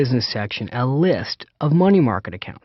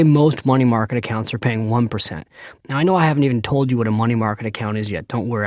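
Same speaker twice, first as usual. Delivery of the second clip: ordinary talk, nothing marked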